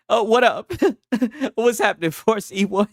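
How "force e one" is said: ordinary talk, nothing marked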